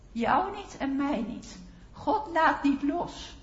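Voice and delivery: loud, emphatic sermon voice; forceful preaching. Real, not acted. Preacher as Ruth urging the congregation to trust God, forceful preaching. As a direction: drive each phrase home to the congregation.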